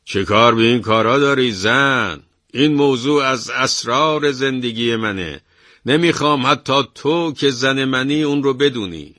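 high, loud theatrical reply. A narrator's reading. Husband answering his wife loudly so the thief hears, staged loud retort. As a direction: boom it out for the eavesdropper. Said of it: "از صدای زنش هم بلندتر".

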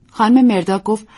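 plain reading voice

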